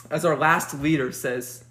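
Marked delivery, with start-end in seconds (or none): none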